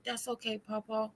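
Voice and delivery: put-on high, soft child voice; lightly voiced sweet little boy. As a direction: grandson gently refusing his grandpa's money, soft and high. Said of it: "And he said".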